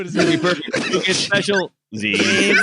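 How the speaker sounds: slow, high, laughing mock praise. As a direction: tease through laughter. Mocking the read, laughing.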